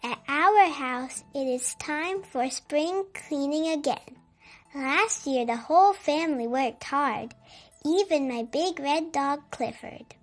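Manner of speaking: ordinary delivery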